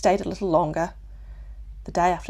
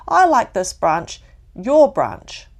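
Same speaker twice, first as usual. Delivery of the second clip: stubborn; 4 semitones higher, 10 dB louder, slower than usual. Acted. Clinging child refusing to move.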